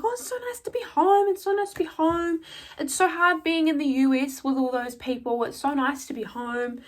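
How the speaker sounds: high mock gushing, easing down by the end; hyping the crowd. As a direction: imitate a crowd-pleaser in a sing-out high voice. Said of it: "she's trying to hype the crowd up".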